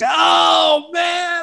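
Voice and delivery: very high, drawn-out mock wail; retold shocked outcry. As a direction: cry out as if stung, then fade. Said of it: "looked like I'd thrown acid in his eyes".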